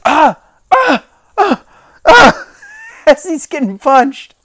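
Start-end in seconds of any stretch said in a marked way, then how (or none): 0.1-2.5 high mock cries of pain; imitated punch grunts. Mic'd wrestler getting beaten up, imitated yelps. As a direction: yelp in bursts as if taking punches. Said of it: "you can hear his".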